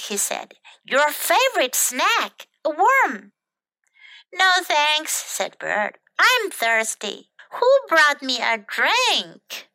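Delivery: lively, animated picture-book reading; children's story narration. A narrator's reading. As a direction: read it to small children with bouncy character lines.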